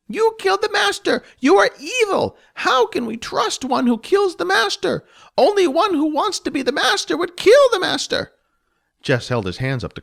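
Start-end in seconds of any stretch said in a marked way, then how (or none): 0.1-8.3 high, loud goblin voice begging for denial; a frantic goblin who cannot believe it; pleading goblin. Acted. Gomet begs Jess to say the master lives.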